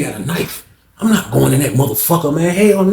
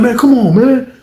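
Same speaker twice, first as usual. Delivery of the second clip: protesting; 10 semitones higher, 5 dB louder, slower than usual. Retold or imitated. Beach dude protesting.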